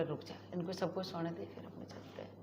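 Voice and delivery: hushed whisper so nobody wakes; big brother whispering the secret plan; retold whisper. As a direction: breathe it under your breath, sneaking out. Brother waits till family sleeps.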